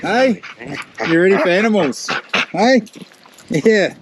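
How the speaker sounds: bright high call to the dog; high pet voice. Real, not acted.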